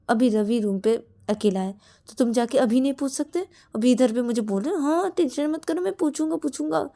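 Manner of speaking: loud, high sarcasm; mock his empty promise; mocking scolding. Shreya mocks Shruti's empty promise, loud and sarcastic.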